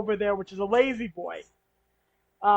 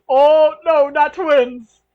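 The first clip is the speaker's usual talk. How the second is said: a louder, higher protest of not twins; 4 semitones higher, 8 dB louder, much slower than usual. Acted. Sonny protests to Rhonda, raised and insistent. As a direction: push back on the twins idea, raised and insistent.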